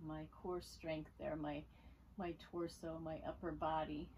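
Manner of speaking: calm, unhurried instruction with long pauses; guided yoga voice. Real, not acted. Yoga teacher easing students into Sphinx pose, calm guiding voice. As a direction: softly guide them through the pose, leaving room to breathe. Leads a gentle yoga practice.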